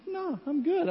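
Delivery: playful imitation of a husband saying no thanks; breezy husband brush-off. Husband refuses again.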